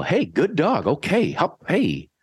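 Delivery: high happy praise for the dog; cheery praise. Shown as a demonstration. Trainer praising the dog after a short sit, high and happy. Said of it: "and then you still".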